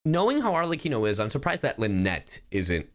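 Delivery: usual speaking voice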